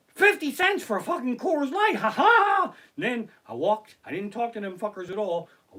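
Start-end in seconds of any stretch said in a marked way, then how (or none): 0.1-2.7 high, loud crowing into a big laugh; gleeful mock amazement. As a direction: crow about the bargain, then cackle. Acted gloating over cheap beer.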